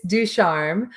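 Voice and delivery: Ducharme drawn out slowly as doo-SHARM, French style; correct surname pronunciation. Shown as a demonstration. Guest patiently repeating her surname for the host, slow and clear. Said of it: "tell me your last correction".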